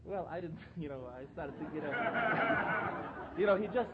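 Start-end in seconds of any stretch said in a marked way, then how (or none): 2.0-3.3 laughter. Real, not acted. Amused at his rabbi's words.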